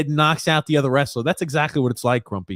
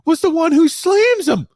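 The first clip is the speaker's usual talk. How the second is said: high, loud stunned disbelief; 11 semitones higher, 4 dB louder than usual. Real, not acted. Fan shocked the peacekeeper threw the punch, high and loud. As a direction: cry out in shocked amazement.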